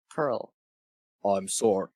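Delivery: ordinary talk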